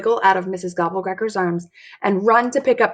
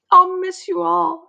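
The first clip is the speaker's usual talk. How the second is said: slow, much higher mock-tragic goodbye; melodramatic farewell; 11 semitones higher, slower than usual. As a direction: play the doomed heroine, hammed up. Dramatic farewell to family.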